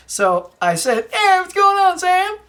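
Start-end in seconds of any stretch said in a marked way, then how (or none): 1.1-2.4 high, loud cheery greeting; friendly hail. Retold or imitated. Fan greeting actor Sam at coffee stand, loud and cheery. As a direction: call out like spotting a star. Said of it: "Just like that".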